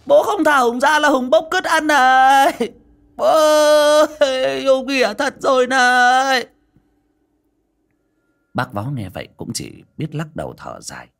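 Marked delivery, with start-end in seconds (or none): 0.1-6.5 high shrieking madman voice; crazed son screaming. A narrator's reading. Son screams at his father from the locked room. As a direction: shriek it like a deranged grown son.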